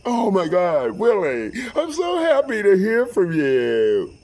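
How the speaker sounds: high, loud, drawn-out delight; effusive greeting. Retold or imitated. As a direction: imitate an overjoyed relative on the phone. Her father delighted by the call.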